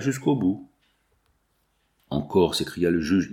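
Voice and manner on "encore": low, irritated exclamation; a judge's exasperation, pitched down. A narrator's reading. Judge facing resistance he thought beaten, low irritation. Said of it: "irrité par ce retour d'une résistance".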